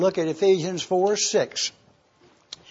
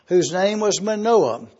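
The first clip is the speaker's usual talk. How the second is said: normal talking voice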